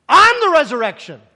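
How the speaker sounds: I'M hit loud and hard; loud insistence on I'M; exasperated emphasis. Retold or imitated. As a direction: punch I'M, then let the rest fall. Jesus acted as exasperated, emphatic.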